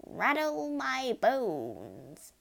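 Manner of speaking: high, very slow, drawn-out wail; spooky parrot voice. A narrator's reading. Parrot imitating a spooky voice, eerie and drawn-out. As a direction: draw each word out like a ghost. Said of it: "wonderful imitation of a spooky voice".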